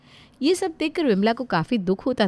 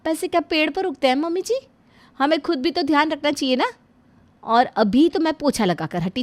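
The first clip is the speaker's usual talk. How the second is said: higher, scolding bahu over a broken cup; scolding daughter-in-law; 6 semitones higher, 3 dB louder than usual. Acted. Daughter-in-law scolding her mother-in-law for the broken cup, sharp and higher. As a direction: scold her like a careless child.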